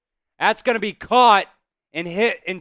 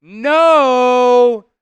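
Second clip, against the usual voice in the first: retold loud refusal; 5 semitones higher, 8 dB louder than usual. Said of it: "And I was like".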